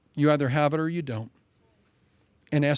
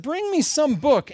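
higher, slower royal command; lightly hammed-up demanding king; restless king; 10 semitones higher, 3 dB louder than usual. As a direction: call for a book like a sleepless king. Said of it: "So he said".

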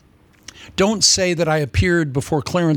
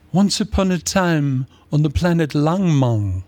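slow, otherworldly storyteller voice; ethereal; slower than usual. Acted. Preacher playing founder of a made-up religion, slow and ethereal. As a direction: drift slowly through it like a cosmic fairy tale. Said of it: "Be ethereal".

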